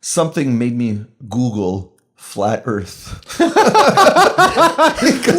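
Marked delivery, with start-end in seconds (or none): 3.4-5.4 high embarrassed laughter; real giggling; high giggle. Real, not acted. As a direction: giggle at the admission. Laughing at own confession.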